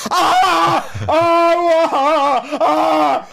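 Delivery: loud high Ah screams, laughing; imitated pain screams. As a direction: scream Ah in mock agony. Mimics boy screaming in pain.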